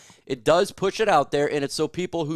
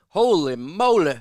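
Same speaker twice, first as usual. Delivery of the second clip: ordinary talk, nothing marked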